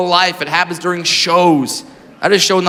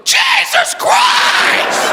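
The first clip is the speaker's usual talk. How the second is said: very high, drawn-out shrieked scream; shrieked Jesus Christ; much slower than usual. Retold or imitated. Woman in the audience shrieking at the comedian. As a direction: scream it slowly at the top of your range. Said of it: "just goes".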